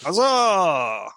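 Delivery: hearty mock huzzah; mock cheer. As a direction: proclaim it like a herald. Mock celebration.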